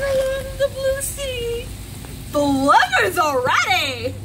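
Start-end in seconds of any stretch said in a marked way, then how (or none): none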